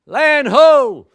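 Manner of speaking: loud, high joyful shout across the deck; ringing call of land ho. Retold or imitated. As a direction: hail it like the lookout spotting shore. Crewman calls sighting land.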